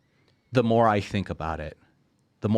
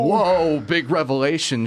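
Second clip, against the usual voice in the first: high, louder mock whoa; mock amazement; 7 semitones higher, 4 dB louder than usual. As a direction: feign shock at the obvious. Mocking an obvious revelation.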